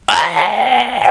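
a sudden high yelp right into the mic; imitated shriek. Imitating Naja's startled yelp into the mic on every episode. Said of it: "Naja eventually goes".

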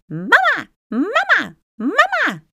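put-on high, loud little bird-boy cheeping; chirping. A narrator's reading. Tiny winged son to his mother, chirping. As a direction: chirp it like an excited sparrow. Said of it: "tjilpte Flip".

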